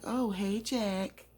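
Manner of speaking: high, chirpy hello; chipper greeting. Retold or imitated. Sad woman greeting Jack, putting on chipper. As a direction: greet him a bit too cheerily. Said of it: "don't sound as chipper as that".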